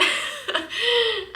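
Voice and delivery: high, real burst of laughter; amused laugh. Real, not acted. Laughs about Target coincidence.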